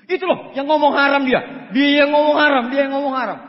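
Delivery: loud, high tattling accusation; loud child's accusation; loud accusing shout. Retold or imitated. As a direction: point and shout he's the one. Little one accusing loudly.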